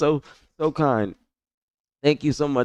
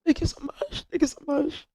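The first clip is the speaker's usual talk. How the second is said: very high, small tearful squeak; real tearful, tiny high voice; choked up; 6 dB quieter than usual. Real, not acted. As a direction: hold back happy tears, softly squeaking. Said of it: "don't be crying".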